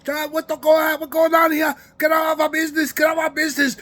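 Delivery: high-pitched mock Chinese accent; imitated Chinese accent. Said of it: "These Chinese folks are like".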